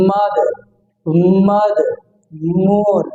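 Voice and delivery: "মাদ" and "মোর" with a held, pressed m. Shown as a demonstration. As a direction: press the lips before opening into "মাদ", "মোর". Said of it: "মুখটা চাপ দিয়ে রেখে".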